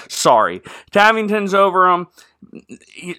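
normal talking voice

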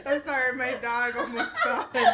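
loud, through laughter; cracking up while explaining; laughing apology. Real, not acted. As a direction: get it out between real laughs. Cracking up over the dog.

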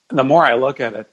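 ordinary delivery

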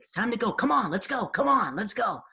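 high, insistent calling; impatient dad. Retold or imitated. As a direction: hurry the kids out. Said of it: "He's like".

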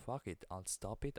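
normal talking voice

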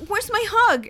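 a lightly hammed-up creepy guy fishing for a hug; mock pushy guy. Mocking guys who demand hugs from strangers, high and mock-sweet. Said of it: "they're like".

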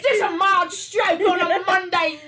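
high, loud laughing exclamation; mock stroke panic. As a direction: ham up the panic while laughing. Mock panic after tongue slip.

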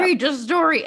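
put-on high, loud whiny kid begging mom to read on; pleading child. Young girl begs her crying mom to keep reading, high whiny plea. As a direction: beg like a little kid who must know the ending. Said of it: "my mom would cry and be like".

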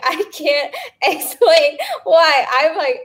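slow, laughing, almost crying; giggly exasperation; laughing helplessly. Real, not acted. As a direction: let the laugh crack through the words. Laughing at her breakouts.

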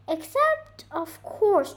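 declaring bird. Acted. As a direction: announce the class rule as the bird. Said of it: "declared the bird".

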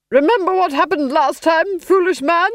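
high, loud, sneering duchess; scornful duchess. Acted. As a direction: belittle the foolish husband.

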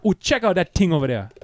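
high, loud fake Jamaican; leering hammed-up Jamaican; Jamaican accent. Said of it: "he's like".

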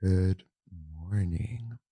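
slow, soft, breathy podcast greeting; ASMR voice. Pretend. [name] performing an ASMR intro on request, slow and soft. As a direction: ease into it like an ASMR video. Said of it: "let's hear it anyway".

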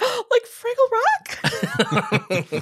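high, loud thrilled squeal; replaying her own delighted discovery; excited gasp. Recalling her first look at the box, thrilled.

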